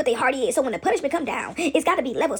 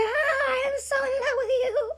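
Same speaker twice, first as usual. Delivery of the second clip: very high lovesick cartoon swoon; hammed-up, drawn-out secret love confession; Helga; 13 semitones higher, slower than usual. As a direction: wail it high, like a smitten cartoon girl. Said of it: "she would go in the closet and say".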